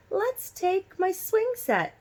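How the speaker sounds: put-on high, slow little-girl voice for D W. A narrator's reading. Reading to kids, little sister D W in a high childish voice. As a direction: pipe it up like a small eager girl.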